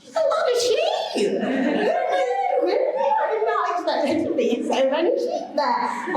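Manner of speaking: high, slow, laughing words. Real, not acted. Speaker laughing at the crowd of sheep noises, high and slow. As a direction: crack up at the crowd's bleating. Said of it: "reacting to the crowd bleating".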